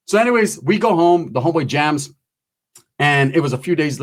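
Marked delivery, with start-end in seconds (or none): none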